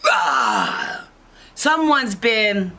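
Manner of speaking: loud growl, then high exclamation; growl, then announce it indignantly; theatrical bear outrage; upset Mama Bear. Acted. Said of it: "then Mama Bear goes".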